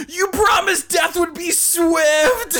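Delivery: high, slow, anguished mock wail; dramatic dying plant. Burger voiced by a friend, a slow anguished wail. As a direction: beg like a doomed martyr.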